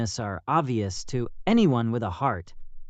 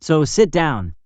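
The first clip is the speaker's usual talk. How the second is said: loud, indignant rebuke of a bully; a young man shouting down a passenger who yelled at a crying girl; angry defense; 5 dB louder than usual. Acted. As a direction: tell the bully off, loud and furious. Stranger defends girl on bus.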